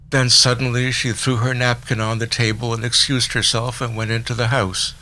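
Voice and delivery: ordinary delivery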